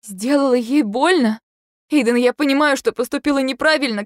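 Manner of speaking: wife shocked by husband's charge; shocked echo. A narrator's reading. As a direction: recoil at the accusation.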